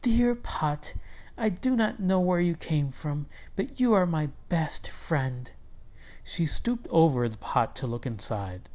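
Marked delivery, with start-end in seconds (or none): 0.0-5.5 higher, hushed and tender; wife embraces the pot, whispering thanks; grateful whisper. Acted. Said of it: "with a grateful heart".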